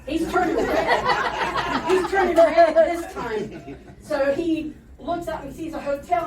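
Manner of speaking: laughing hard while telling it; cracking up at own joke. Real, not acted. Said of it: "teller laughing at own joke".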